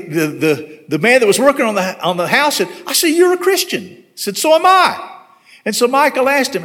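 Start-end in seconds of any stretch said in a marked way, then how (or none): 2.9-3.8 retold, high-pitched happy surprise; pleasantly amazed, pitched right up; delighted discovery. Retold moment of glad discovery. 4.4-5.2 retold high, louder exclamation; excited, pitched way up; delighted reply.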